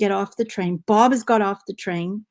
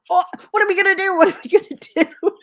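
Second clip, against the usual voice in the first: high, fast mock panic; panicked brother; 11 semitones higher, 7 dB louder, faster than usual. Brother stuck on the train, in high fast mock panic. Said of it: "my brother is like".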